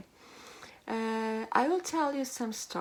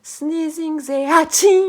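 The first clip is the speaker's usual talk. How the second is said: animal impressions; 6 semitones higher, 15 dB louder than usual. Shown as a demonstration. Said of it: "a lot of sounds from the, the animals".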